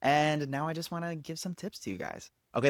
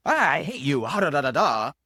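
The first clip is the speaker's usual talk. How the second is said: raised, hammed-up dramatic outburst; mock high intensity; 3 semitones higher, 4 dB louder than usual. Coach demonstrating a monologue's intense opening, mock angry outburst. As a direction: throw out a half-serious intense rant. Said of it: "starting off a little high intensity".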